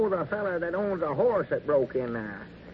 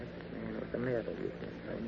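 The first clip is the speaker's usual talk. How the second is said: much softer, lower exasperated aside; hushed aside; 5 semitones lower, 11 dB quieter than usual. Acted. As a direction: scold a partner so the caller can't hear. Hissed aside during phone call.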